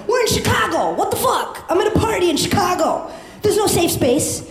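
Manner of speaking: high-pitched exclaiming rant; mock outraged outburst. Comic telling the crowd off, outraged. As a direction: cry out in comic disbelief.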